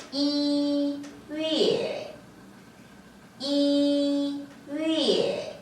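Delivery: 이 held long and level, 월 falling, very slow; original tone. Shown as a demonstration. Teacher modelling the word for January, 이 slow and level. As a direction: model 이 on a steady tone, then drop on 월. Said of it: "원음 그대로 한번 볼까요".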